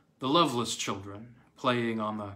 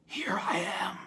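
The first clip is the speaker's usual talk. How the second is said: eerie, higher whispered voice; undead woman; 6 semitones higher than usual. A narrator's reading. Dead Reba greets her horrified family, eerie whisper. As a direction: whisper it like a walking corpse. Risen corpse speaks to family.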